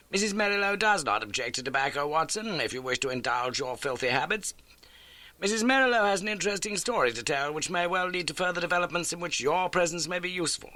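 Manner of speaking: a crisp, brisk detective; Holmes. A narrator's reading. Holmes introduces the landlady to Watson, brisk.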